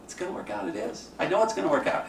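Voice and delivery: high, upbeat reassuring voice; mock sunny self-reassurance; hopeful optimist. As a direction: chant it like a cheerful optimist. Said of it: "They go".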